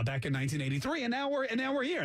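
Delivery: ordinary delivery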